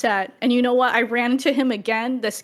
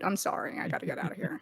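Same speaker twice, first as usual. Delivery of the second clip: quiet apology; 3 semitones lower, 10 dB quieter, faster than usual. Retold or imitated.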